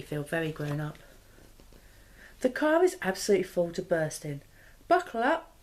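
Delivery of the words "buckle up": higher, eager call to the car; rally everyone for the trip; cheerful cry. Acted. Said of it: "cries Dad".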